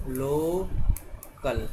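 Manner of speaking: Local stretched out very slowly, syllable by syllable; teacher slowly sounding out local; slow demo. Shown as a demonstration. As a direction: draw out local as lo-kal for the class. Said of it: "जैसे हम बोलें local".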